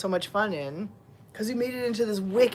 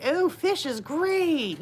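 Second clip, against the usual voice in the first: cats' voice; 8 semitones higher, slower than usual. Retold or imitated. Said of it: "They're like".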